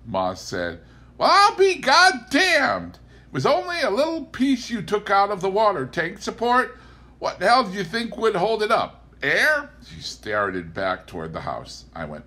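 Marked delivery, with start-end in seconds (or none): 1.2-9.7 put-on very high, loud exasperated old woman; Ma scolding Pa about the tank support; Ma Kettle voice. A narrator's reading. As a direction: berate him with disgust. Ma berating Pa.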